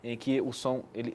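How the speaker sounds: ordinary talk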